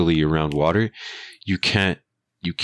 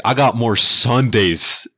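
slowly, higher and louder, dad bragging; dad's slow loud boast; 6 semitones higher, 4 dB louder, slower than usual. Retold or imitated. Dad brags to the rude driver, slow loud boast. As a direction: boast it slow like a proud veteran. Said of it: "My dad's like".

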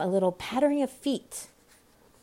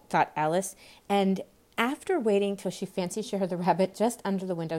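plain reading voice

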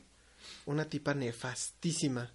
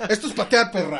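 raised, louder playful shout; mock battle cry; 5 semitones higher, 9 dB louder than usual. Riffing on a friend's kicking story, mock battle cry. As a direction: shout it like a hammed-up action hero.

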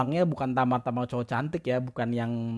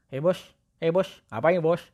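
lightly raised, coaxing voice to a cat; cooing at a cat; cat baby talk; 4 semitones higher than usual. Retold or imitated. Man coaxing his cat to come over, playful.

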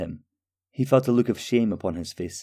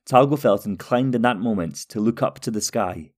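plain reading voice

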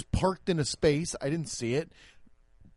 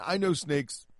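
mock confidence. Shown as a demonstration. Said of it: "It was too long of a pause".

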